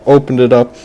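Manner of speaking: plain reading voice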